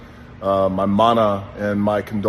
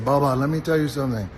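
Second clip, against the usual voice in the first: imitating a thick Iranian accent, higher and quick; a fond, higher Iranian-accented impression of a mentor; Iron Sheik accent; 4 semitones higher, faster than usual. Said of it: "he had this great accent".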